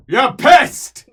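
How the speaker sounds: loud, high jeer; mocking shout. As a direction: yell it across the room. Slayer laughing at drunk.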